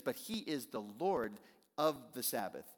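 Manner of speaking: normal talking voice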